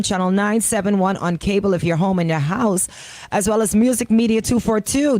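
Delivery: loud, slow live broadcast plug; announcer plug. Acted. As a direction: broadcast the channel list to listeners. Announcing live stream channels.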